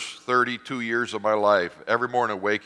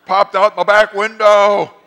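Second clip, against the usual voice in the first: mock elation; 10 semitones higher, 13 dB louder than usual. Pastor choosing joy after the crash, loud mock glee. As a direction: cheer about the damage like it's great news. Mock celebrating his disaster.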